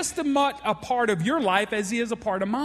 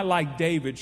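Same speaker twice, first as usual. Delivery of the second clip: ordinary talk, nothing marked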